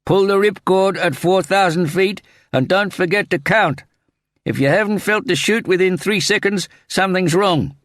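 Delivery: high, loud, sustained yelling; skydiving instructor yelling in your ear; shouted instructions. A narrator's reading. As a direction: shout instructions over a deafening wind. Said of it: "he heard a voice shout in his ear".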